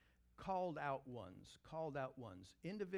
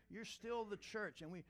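usual speaking voice

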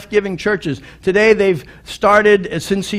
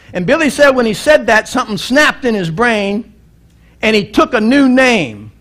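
usual speaking voice

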